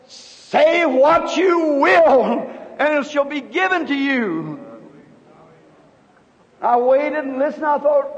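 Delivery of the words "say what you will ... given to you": slow, solemn, higher voice of a divine speaker; a slow, solemn voice speaking down from above. Retold or imitated. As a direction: let each word fall slowly like a command from heaven. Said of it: "something said".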